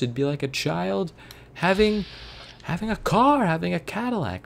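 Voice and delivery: mocking reenactor voice, pitch shooting up; old-timey voice. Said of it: "in, like, his old-timey voice".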